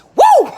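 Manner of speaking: loud, high joyful woo; celebratory yell; excited whoop. Real, not acted. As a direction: whoop with pure hype. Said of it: "I'm excited about this".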